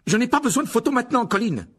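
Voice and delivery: high, loud exasperated shout; forceful cry. A narrator's reading. Injured Harry to Colin with his camera, forceful cry. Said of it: "s'écria-t-il avec force".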